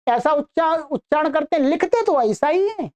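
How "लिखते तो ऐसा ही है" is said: normal talking voice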